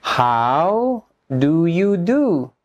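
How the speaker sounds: very slow, drawn-out words for learners; slow teacher demonstration; repeat-after-me model. Shown as a demonstration. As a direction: model the phrase word by word, slowly. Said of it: "Repeat after me".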